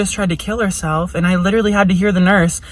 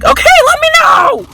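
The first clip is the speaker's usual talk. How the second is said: very high, cartoonish squeak, a bit louder; squeaky high voice; 3 dB louder than usual. To the nurses, asking why she's there, in a squeaky high voice. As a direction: push the pitch way up into a silly squeal.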